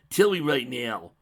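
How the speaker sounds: loud, slow demand. Acted. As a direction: demand it firmly. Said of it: "demanded the dragon".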